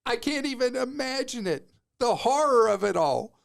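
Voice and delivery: high-pitched, aghast disbelief; mock horror. Real, not acted. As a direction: play up the horror of it all. Mock outrage at insurance-company plans.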